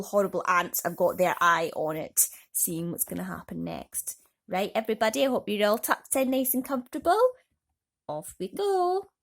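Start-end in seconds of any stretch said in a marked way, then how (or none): none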